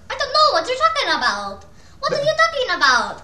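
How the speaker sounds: loud, high mock-Italian accent. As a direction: ham up an Italian accent at full volume. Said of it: "That's Italian".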